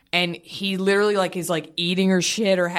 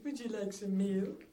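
much softer, near whispered offer; 20 dB quieter than usual. Real, not acted. Mother feeding her baby.

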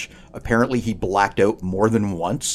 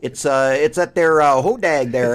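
badly faked northern accent, lifted in pitch; joking, poorly done northern accent; bad regional accent; 4 semitones higher than usual. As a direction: try a hokey northern accent. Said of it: "That's a bad accent as well".

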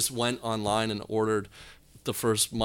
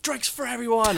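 high, celebratory call-out; playful big-spender holler; imitated bar announcement; 13 semitones higher, 4 dB louder than usual. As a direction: announce a round to the whole bar, pitched way up.

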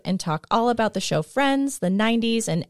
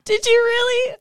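shocked. Real, not acted. Shocked reaction.

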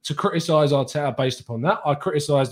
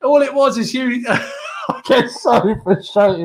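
higher, louder teasing shout; playful shout; 8 semitones higher, 3 dB louder, slower than usual. Real, not acted. Teasing co-host Hugh with a higher, louder shout. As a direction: jab at your mate.

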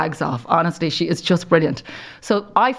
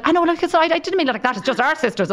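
flustered backpedal; 10 semitones higher than usual. Retold or imitated. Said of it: "she said".

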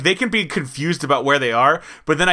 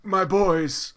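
slow, raised, put-on wizard greeting; old wizard voice; 4 semitones higher, 3 dB quieter, slower than usual. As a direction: draw it out like a doting old wizard. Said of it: "he's like".

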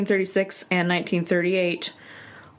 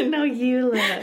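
high giggly coo; giggly late night call; 6 semitones higher than usual. Pretend. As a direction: laugh it out like smitten lovers. Lovers refusing to hang up.

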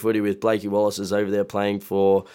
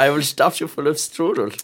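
mock German accent; 3 semitones higher than usual. Joke German villain threat.

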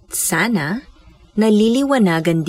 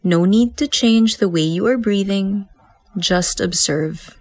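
guided meditation. Acted. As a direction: guide them gently into stillness. Leads breath meditation.

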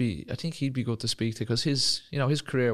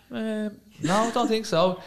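put-on high, timid young lad; naive reply; 10 semitones higher, slower than usual. Sixteen-year-old to the manager, timid and clueless. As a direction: answer meekly, clueless. His own younger self retold.

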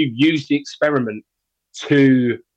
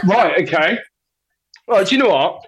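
ordinary talk, nothing marked

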